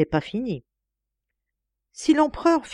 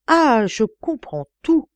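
excited realization; 6 semitones higher, 5 dB louder, slower than usual. Acted. Old agent grasps the truth.

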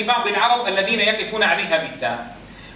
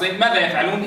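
usual speaking voice

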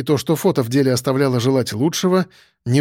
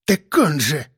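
mocking mimicry; slower than usual. A narrator's reading. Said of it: "зло передразнил его шеф".

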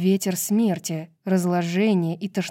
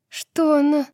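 higher-pitched, startled half-question; alarmed, raised-pitch question; 8 semitones higher, 3 dB louder than usual. Acted. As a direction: blurt it high, as if cut off. Bryce's question amid terrifying fight.

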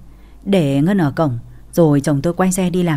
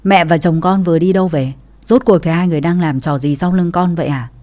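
ordinary delivery, nothing marked

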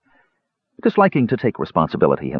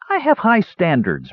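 higher, a sniffy comeback; haughty retort; 10 semitones higher than usual. A narrator's reading. Palin retorting to his brother, haughty. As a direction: snap back with pride. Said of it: "retorted Palin".

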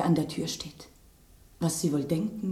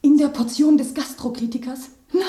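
high, loud horrified disbelief; alarmed rising cry; shocked; 8 semitones higher, 7 dB louder than usual. Acted. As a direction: gasp out the realisation. Horrified realisation of poisoning.

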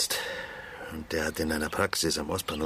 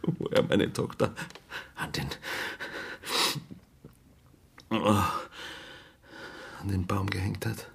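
fading to a near whisper, much softer; breaking down; 6 dB quieter than usual. Acted. Father tells where his daughter was hanged, breaking down. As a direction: voice giving out with grief.

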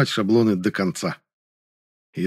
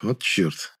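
ordinary delivery, nothing marked

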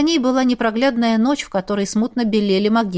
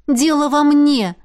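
higher, drawn-out interjection; cutting in; 6 semitones higher, 4 dB louder than usual. A narrator's reading. Woman taking the blame, interrupting him. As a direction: break in firmly over his tirade. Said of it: "перебила его Миранда".

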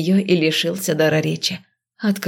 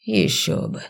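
grumbling; 4 semitones higher, 4 dB quieter, slower than usual. Acted. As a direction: grumble it dryly. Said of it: "буркнул некромант".

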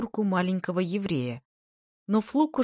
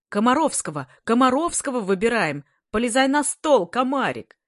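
high, loud eager calls; crowd shouting; 7 semitones higher, 6 dB louder than usual. A narrator's reading. Pupils urge their nominee onto the table, shouting. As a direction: call out over the noise.

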